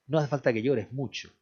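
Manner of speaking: usual speaking voice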